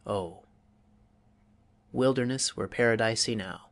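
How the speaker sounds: unhurried lyrical reading, pauses between phrases; gentle poem reading; verse recitation. Acted. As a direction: savour each image before moving on. Recites second verse example.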